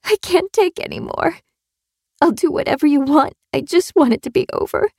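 high, thin pleading whimper; frightened whimper. A narrator's reading. Captive gives in to her captor, frightened whimpering. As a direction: whimper high, begging for it to end. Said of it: "her voice dropped to a frightened whimper".

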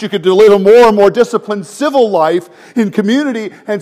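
loud and laughing, mocking rival teachers' promises; building preacher's shout. Real, not acted. Preacher to congregation, mocking self-rescue, loud and laughing. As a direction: ride the swell into a near shout with a laugh.

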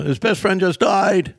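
high, drawn-out announcement; stage introduction. Retold or imitated. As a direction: imitate a host bringing on an act, high and slow. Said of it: "this is how he brings me up".

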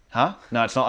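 ordinary delivery

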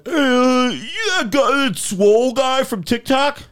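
imitating a stranger. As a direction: squeak it out like a starstruck fan. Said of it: "He's like".

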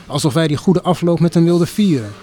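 ordinary delivery

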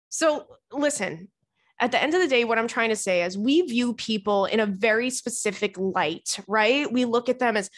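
ordinary talk

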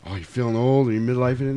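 ordinary talk, nothing marked

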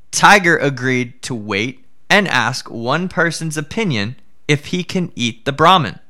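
plain reading voice

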